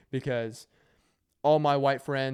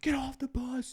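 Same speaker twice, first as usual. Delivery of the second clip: mock sergeant scream; 11 semitones higher than usual. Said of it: "like, screaming at him".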